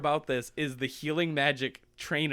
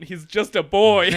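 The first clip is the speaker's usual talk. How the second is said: loud, higher, hammed-up plea; mock pleading king; 4 semitones higher, 10 dB louder than usual. King begging the trainer, mock desperation. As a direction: beg for mercy with a laugh underneath.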